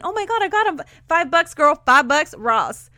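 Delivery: high and loud, spilling the bargain price; gushing overexcited brag. Shown as a demonstration. Friend gushing over cheap shoes, excited squeal. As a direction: squeal about the five-dollar shoes. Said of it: "versus saying".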